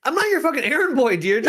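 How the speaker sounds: high, exasperated protest; indignant outburst. Acted. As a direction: push back hard at the errand. Player protests loudly.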